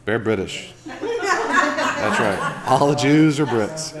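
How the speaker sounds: laughing. Real, not acted.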